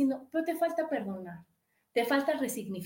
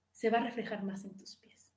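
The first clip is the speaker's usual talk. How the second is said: hushed, softer warning; whisper; 4 semitones lower, 6 dB quieter than usual. Real, not acted. Host warning listeners about their feet, whispered. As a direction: drop to a whisper for the point.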